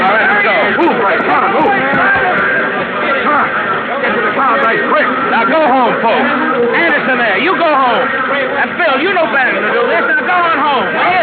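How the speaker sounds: high, loud commands to the crowd; shouting over a mob. Acted. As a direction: yell the folks home above the noise.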